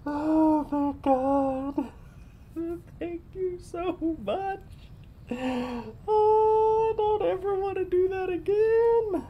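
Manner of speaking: very high, squeaky tearful wail; crying with relief. Real, not acted. Gamer finally past a hard boss, tearfully relieved.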